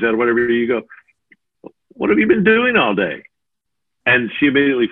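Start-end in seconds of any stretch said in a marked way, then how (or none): 1.9-3.2 high, pointed accusing question. Retold or imitated. Husband home from work, accusing his wife. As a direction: demand it like an accusation. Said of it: "repeat that back with a different tone".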